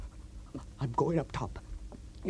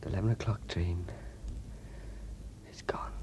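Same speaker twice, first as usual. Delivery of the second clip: soft, lower broken whisper; grieving whisper; 6 semitones lower, 10 dB quieter than usual. Acted. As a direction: barely breathe the words in grief. Grieving whisper over lost girl.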